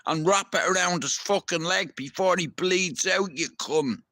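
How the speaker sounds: a fed-up yell at someone who won't help; imagined angry rant. Retold or imitated. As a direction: let him have it, exasperated and heated. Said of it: "And I'm thinking".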